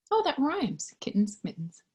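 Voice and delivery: ordinary delivery, nothing marked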